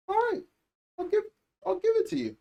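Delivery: tiny falsetto concession; squeaky high voice. Pretend. Reviewer grudgingly giving the show credit, squeaky falsetto.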